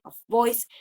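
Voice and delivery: ordinary delivery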